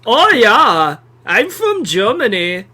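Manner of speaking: hammed-up German accent with swooping tune; campy mock German accent. Shown as a demonstration. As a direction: show off a comic German accent. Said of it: "accurate German accent".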